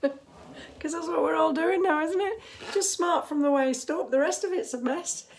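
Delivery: giggly self-mocking aside; amused laughing. Real, not acted. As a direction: chuckle while joking. Laughing about slippers.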